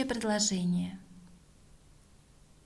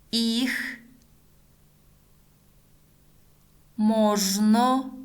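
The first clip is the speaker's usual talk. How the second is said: slow word-by-word dictation; one word at a time, long pauses; dictating; much slower than usual. Shown as a demonstration. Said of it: "Следующее предложение".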